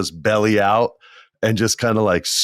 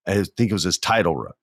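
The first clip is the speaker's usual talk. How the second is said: ordinary talk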